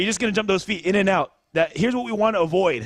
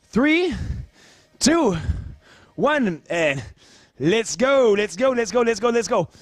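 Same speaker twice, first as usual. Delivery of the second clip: hype countdown; 3 semitones higher, slower than usual. Real, not acted. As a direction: pump them up: count and shout go. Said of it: "Here comes my timer".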